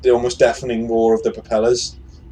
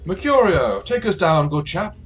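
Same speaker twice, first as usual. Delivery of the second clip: high, loud toff calling his man; gentleman giving orders; 6 semitones higher, 5 dB louder than usual. Acted. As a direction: order the servant grandly. Airship gentleman orders his servant.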